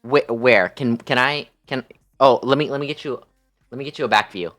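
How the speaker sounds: usual speaking voice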